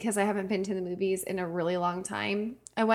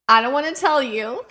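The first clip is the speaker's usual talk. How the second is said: blurted reluctance; 5 semitones higher, 7 dB louder than usual. Retold or imitated. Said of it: "And I'm like".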